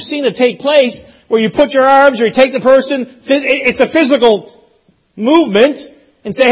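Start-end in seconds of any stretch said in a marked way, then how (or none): none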